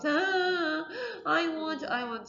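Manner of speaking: high mock wail that sinks; whining crying voice. Shown as a demonstration. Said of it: "It's the loud crying voice".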